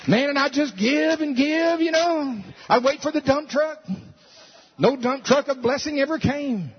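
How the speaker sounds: high, loud mock complaint; frustrated giver. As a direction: whine as the let-down believer.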